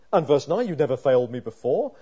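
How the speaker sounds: loud, urgent sermon voice; pleading with God on the psalmist's behalf; fervent preaching. Acted. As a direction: preach it out with full force. Preaching verse nine with force.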